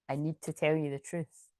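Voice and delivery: low, soft confession; solemn confession. Acted. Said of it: "I just said it like that".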